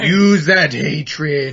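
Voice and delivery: slow, menacing mentor voice; slow sinister voice. Retold or imitated. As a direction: drawl it out like a cold trainer. Quoting the villainous guild mentor.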